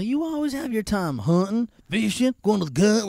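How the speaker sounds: very high, whining mock wife; hammed-up nagging wife voice; whiny wife. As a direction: whine like a neglected wife.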